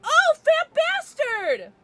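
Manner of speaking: loud, high, drawn-out squeal of delight; excited outburst. Real, not acted. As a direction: exclaim it like a thrilled fan. Delighted outburst at recalling a character.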